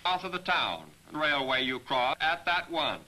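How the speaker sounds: ordinary talk, nothing marked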